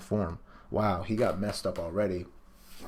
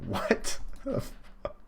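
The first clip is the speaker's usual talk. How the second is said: shocked Jack; much slower than usual. Acted. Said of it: "Jack's like".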